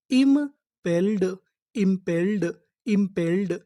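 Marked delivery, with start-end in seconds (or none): 0.1-1.2 slow drawn word. Shown as a demonstration. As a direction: sound out impelled slowly and clearly. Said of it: "तो हमारी पूरी meaning क्या बनी?". (धीरे और ज़ोर से बोलना)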